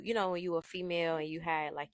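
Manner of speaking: ordinary delivery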